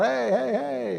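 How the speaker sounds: stuck in mud, calling to strangers, high and loud. Retold or imitated. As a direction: hail a couple far away. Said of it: "So I called out".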